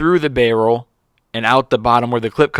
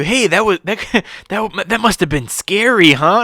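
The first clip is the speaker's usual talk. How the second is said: put-on a touch higher, coddling like speaking to a child; mock patronizing manager; 8 semitones higher than usual. Friend mimics the manager soothing a grown man, lightly mocking. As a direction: talk down to him like a little kid, with a laugh. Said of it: "the way that the guy talked to you".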